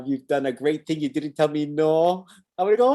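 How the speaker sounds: usual speaking voice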